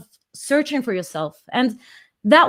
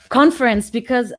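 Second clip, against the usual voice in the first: ordinary delivery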